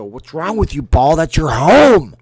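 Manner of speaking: loud high angry yelling at the golf ball; furious high-pitched rant at a golf ball; Billy Madison impression. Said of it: "like Billy Madison".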